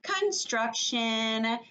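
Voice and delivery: "Construction" stretched out very slowly; drawn-out model word. Shown as a demonstration. Slow model of new word.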